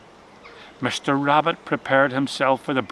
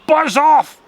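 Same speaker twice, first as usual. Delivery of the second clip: high, loud rabbit outcry; cry out; 10 semitones higher, 7 dB louder than usual. A narrator's reading. Rabbit crying out loudly at the noises. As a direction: shoo the noise away. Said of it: "cried the rabbit".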